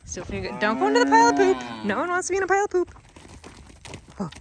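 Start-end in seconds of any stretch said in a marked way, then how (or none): 0.6-2.9 much higher, louder sing-song to the goats. Real, not acted. Talking to the baby goats, high, loud coaxing baby talk.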